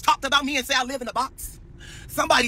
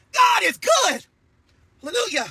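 much higher, ringing praise shout; 6 semitones higher than usual. Real, not acted. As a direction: cry it out to the sky. Repeated praise cry.

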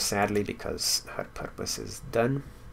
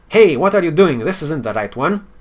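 loud, higher teasing leprechaun; 6 semitones higher, 10 dB louder than usual. Acted. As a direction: tease him like a mischievous leprechaun. Leprechaun taunts picking wrong dragon.

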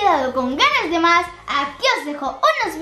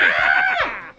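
high shriek; 14 semitones higher than usual. Real, not acted. Shriek continues.

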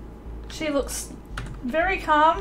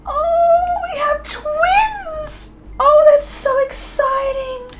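much higher, slow, half-crying delight; tearful joy; 14 semitones higher, 4 dB louder, much slower than usual. Real, not acted. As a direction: squeal through happy tears. Twins, near-tearful joy.

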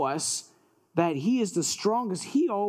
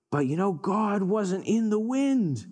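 ordinary delivery

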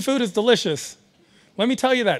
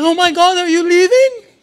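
mock worried dad; 6 semitones higher, 4 dB louder than usual. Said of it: "He's like".

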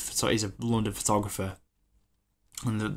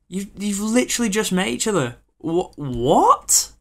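baffled outburst; 10 semitones higher, 8 dB louder than usual. Real, not acted. Exasperated reaction to film.

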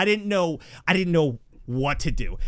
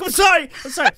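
much higher and louder; panicked apology; 13 semitones higher, 10 dB louder, faster than usual. Retold or imitated. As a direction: yell the apology while fleeing. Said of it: "I'm like".

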